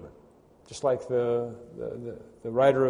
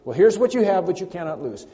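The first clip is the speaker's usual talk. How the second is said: normal talking voice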